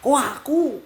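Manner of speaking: high, incredulous objection; indignant protest. Real, not acted. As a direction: push the blame back, pitch shooting up. Membantah disuruh gantian menolong. (protes tak terima, nada tinggi)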